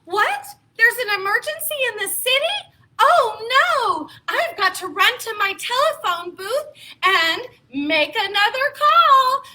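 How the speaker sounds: mock emergency call. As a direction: play the hero taking an urgent call. Pretend superhero phone call.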